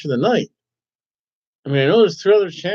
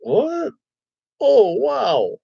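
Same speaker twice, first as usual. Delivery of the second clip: surprised; 7 semitones higher, much slower than usual. Retold or imitated. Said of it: "'cause I'm just like".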